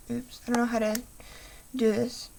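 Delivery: ordinary talk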